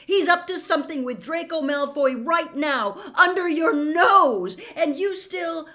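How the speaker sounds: loud, high angry accusation. Acted. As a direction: hammer the accusation home. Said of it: "as loudly as before".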